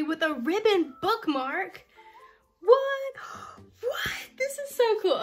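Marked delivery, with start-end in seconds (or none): 2.6-5.2 high loud shrieks of joy; shrieking delight. Real, not acted. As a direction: shriek it, thrilled. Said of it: "Okay, I'm sorry".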